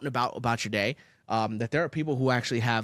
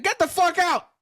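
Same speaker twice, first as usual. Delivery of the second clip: high, loud imagined yell; kicking out a fork user, yelling; mock shout; 5 dB louder than usual. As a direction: throw them out loudly. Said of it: "I'd look at you and be like".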